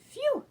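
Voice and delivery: high, loud relieved sigh; dog's relieved phew. A narrator's reading. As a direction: blow out relief. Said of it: "said the dog".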